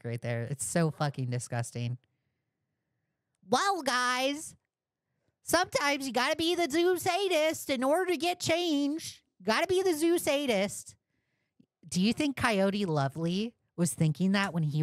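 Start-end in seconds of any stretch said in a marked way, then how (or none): 3.5-11.0 much higher mocking justifier voice; mocking impression. Streamer parodying the zoo justification, high mock voice. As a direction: lampoon his logic, singsong. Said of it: "Listen to that fucking justification".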